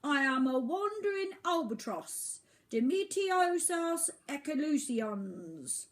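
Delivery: high, loud grand bird voice; pompous albatross. Acted. Albatross introducing himself to Oliver, pompous high voice. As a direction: announce yourself grandly.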